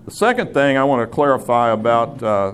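ordinary talk

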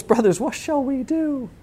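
high, hushed, anxious plea; freaking out. Acted. Frightened crowd asking the apostles for help, freaking out. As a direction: ask it in a small, high panicked voice. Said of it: "They're freaking out".

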